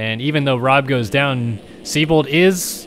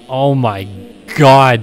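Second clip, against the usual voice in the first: surprised; 7 dB louder, slower than usual. Real, not acted. Reacting to riders getting dirty.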